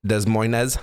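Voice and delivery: Des Moines with every S sounded; mock-literal reading of Des Moines, extra final syllable; jokey mispronunciation. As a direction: say Des Moines as "dez moy-nezz". Jokey misreading of town name.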